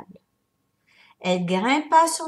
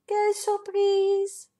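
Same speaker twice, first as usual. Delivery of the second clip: surprise; 10 semitones higher, slower than usual. A narrator's reading. Narrator marvels at discovery.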